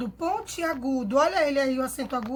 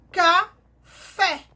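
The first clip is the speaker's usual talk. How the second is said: café broken slowly into ca and a strong FÉ; stressed syllable demo; 3 semitones higher, 6 dB louder than usual. Shown as a demonstration. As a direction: draw out café, landing hard on the final fé. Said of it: "A sílaba mais forte".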